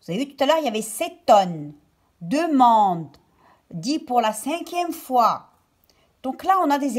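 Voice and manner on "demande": ordinary talk, nothing marked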